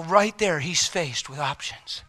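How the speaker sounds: usual speaking voice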